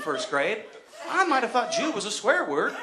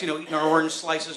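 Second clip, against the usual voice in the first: ordinary talk, nothing marked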